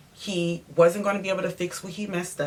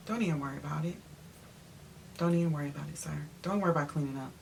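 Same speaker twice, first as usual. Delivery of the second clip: very soft, low brush-off; retold weary dismissal, voice dropped right down; resigned dismissal; 3 semitones lower, 11 dB quieter, much faster than usual.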